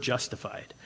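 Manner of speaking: low, hushed savoring of justified; soft emphasis. Real, not acted. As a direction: let the word justified land softly and low. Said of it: "I love this word".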